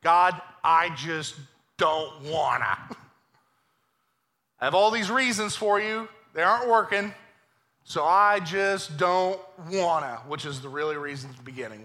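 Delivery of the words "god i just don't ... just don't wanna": whiny reluctance. Retold or imitated. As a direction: drag it out like a sulky kid. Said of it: "what Moses is really saying".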